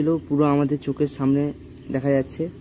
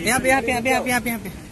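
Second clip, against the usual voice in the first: excited pointing; 10 semitones higher, faster than usual. Real, not acted.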